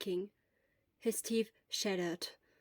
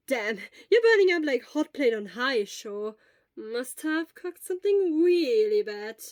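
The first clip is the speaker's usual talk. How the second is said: worried friend; 7 dB louder than usual. Acted. Friend exclaims at his fever.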